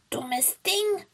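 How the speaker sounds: high, scolding witch voice; high-pitched cross witch; witch snapping. A narrator's reading. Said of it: "fuhr die Hexe sie dann an".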